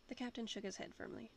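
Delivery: plain reading voice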